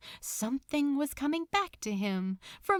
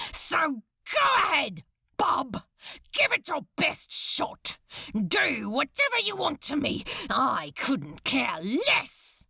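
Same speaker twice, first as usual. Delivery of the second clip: defiant shouting; 5 semitones higher than usual. Acted. Crowley taunting Beelzebub, defiant shout. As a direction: dare him to do his worst.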